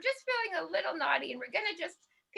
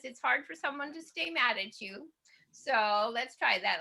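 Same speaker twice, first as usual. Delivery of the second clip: ordinary delivery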